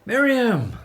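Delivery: loud high call of her name. Acted. Father greets his daughter with a loud call.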